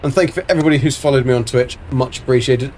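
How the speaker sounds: ordinary talk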